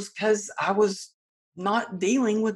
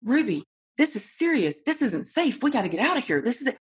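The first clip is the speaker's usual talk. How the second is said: put-on higher, urgent snap at the child; tense mother bark, pitched up; snapping; 7 semitones higher than usual. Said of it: "And I snapped at her".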